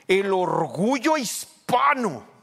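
high, slow, booming imitation of a celebration announcer; loud proud proclamation. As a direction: proclaim it with drawn-out pride, very loud. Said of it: "yo he oído así".

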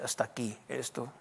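dropped to a soft, low murmur; hushed inner voice. Retold or imitated. Pastor voicing his own body's hushed warning. Body says stop, voiced quietly.

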